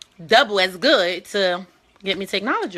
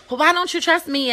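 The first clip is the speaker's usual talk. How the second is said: higher-pitched imitation of a complaining partner; mock protest; 8 semitones higher than usual. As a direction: mimic a whiny objection, pitched up.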